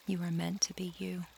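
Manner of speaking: normal talking voice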